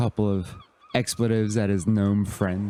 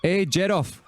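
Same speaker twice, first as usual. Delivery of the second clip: pitched-up calling out to a gnome; half-hearted yell, higher than usual; mock yell; 10 semitones higher than usual. Turtle calling his gnome over, mock yell. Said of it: "yelling at one of his gnomes".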